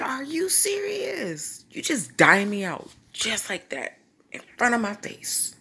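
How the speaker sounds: high, drawn-out incredulous protest; slow, indignant squeal easing down; mock outraged disbelief. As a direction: act out feeling betrayed by a brother. Confronts brother who told on her.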